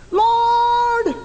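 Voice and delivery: high, long-held cry of "Lord". Acted. Syrophoenician mother calls on the Lord, crying out. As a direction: call out to heaven on one long note. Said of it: "crying out".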